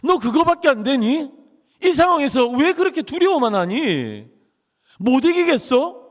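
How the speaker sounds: a hint of a scolding God; mock scolding. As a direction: scold like a disappointed judge, half acted. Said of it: "하나님이 우리를 꾸짖으십니까".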